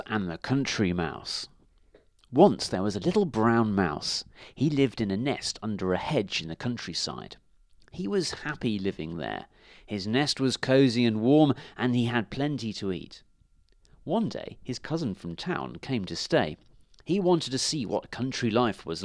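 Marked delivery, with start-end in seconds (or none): none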